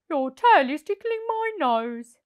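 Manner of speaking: tickled Percy. Acted. Percy complains.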